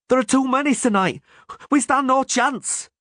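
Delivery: high desperate pleading; frightened plea. Acted. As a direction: beg in fear.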